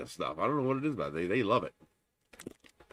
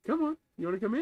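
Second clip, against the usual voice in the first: talking to a cat; 11 semitones higher, much faster than usual. Real, not acted. Coaxing cat into box.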